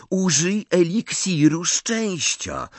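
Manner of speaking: high, slow and stretched; excited urging. A narrator's reading. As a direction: spell out the brilliant idea slowly. Ron wyjaśnia swój pomysł. (z przejęciem, wysoko i przeciągle)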